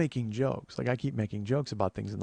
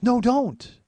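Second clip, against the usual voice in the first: high, loud startled no don't; alarmed cry; 13 semitones higher, 9 dB louder than usual. Retold or imitated. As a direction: blurt it out in alarm. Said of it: "she goes".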